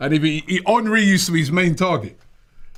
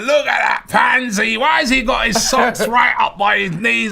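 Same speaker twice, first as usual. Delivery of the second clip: slow, higher mock Scottish grumble, a bit louder; bad Scottish accent; 7 semitones higher, 4 dB louder than usual. Scottish fan moaning about Henry's socks, mock Scottish accent. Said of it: "That's not a very good Scottish accent".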